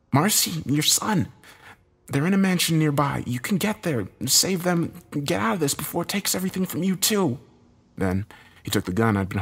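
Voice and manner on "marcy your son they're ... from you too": high-pitched, urgent instructions; hurried plea in a raised pitch; urgent mutter. A narrator's reading. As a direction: press him to go, without shouting. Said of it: "grabbed me and muttered".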